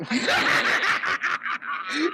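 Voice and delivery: high, soft cackle; gleeful cackle; high laugh. Real, not acted. Laughing at story.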